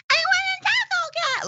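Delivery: very high-pitched squeal of joy; girl-like joyful shriek. Retold or imitated. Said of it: "I literally shrieked in, like, ch-, girl-like joy".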